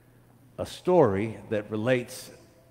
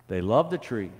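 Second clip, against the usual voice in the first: ordinary delivery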